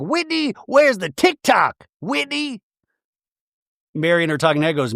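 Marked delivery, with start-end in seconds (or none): 0.0-2.6 high, slow, put-on calling voice; mimicking Meredith calling. Mary mimicking Meredith calling Whitney about the TikTok, high mock voice. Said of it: "you was like".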